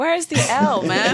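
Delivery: high-pitched comic protest; mock exasperation. Guest retorts to people misreading surname, mock exasperated. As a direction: protest in disbelief, about to laugh.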